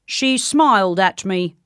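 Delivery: put-on accusing official voice; solemn soldier's accusation. A narrator's reading. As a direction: repeat the charge as a grave guard. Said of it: "repeated the soldier".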